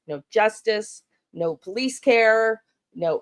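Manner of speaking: ordinary delivery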